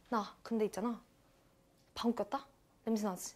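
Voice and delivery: very soft, secretive near-whisper; hushed confession. Acted. Woman admitting to a friend she farted, hushed and furtive. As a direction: confide it furtively, barely audible.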